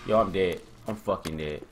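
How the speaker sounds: low, hushed repetition; quiet, sinking resignation; resigned dread. Real, not acted. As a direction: say it low and soft, as if doomed. Resigned to dying in game.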